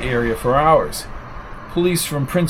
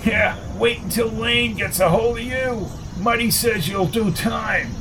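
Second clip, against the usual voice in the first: higher pitched gossipy neighbour voice; higher, needling busybody stirring trouble; Arnie the busybody; 7 semitones higher, 4 dB quieter than usual. A narrator's reading. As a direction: play the nosy troublemaker spreading gossip. Arnie taunting from the gate.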